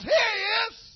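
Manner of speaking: loud, high triumphant shout; exposing shout. Retold or imitated. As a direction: call him out to the whole room. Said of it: "He goes".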